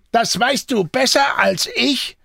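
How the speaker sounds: high, heated retort; angry snapping. A narrator's reading. Jean rebuffs the confused Antoine, snapping angrily. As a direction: snap back accusingly. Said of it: "fauchte ihn Jean an".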